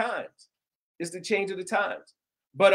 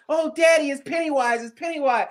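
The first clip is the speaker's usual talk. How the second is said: put-on higher, excited little girl calling out; excited daughter; 7 semitones higher, 3 dB louder than usual. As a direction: imitate a thrilled kid spotting Pennywise. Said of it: "she kept going off like".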